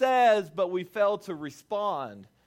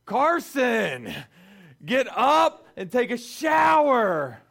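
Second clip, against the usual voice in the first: exasperated parental shout, louder and higher; griping parent; 5 semitones higher, 7 dB louder, slower than usual. Retold or imitated. Dad to son glued to Xbox, exasperated shout. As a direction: holler it slowly at a kid who won't budge. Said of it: "we are griping at him".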